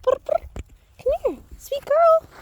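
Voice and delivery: very high sweet voice coaxing an animal; pet talk. Real, not acted. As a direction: coo up high to a shy goat. Calling a goat over.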